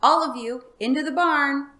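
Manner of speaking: high, loud herding call; yelling. Acted. Farmer yelling to his cows to go into the barn, loud. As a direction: holler the cows home.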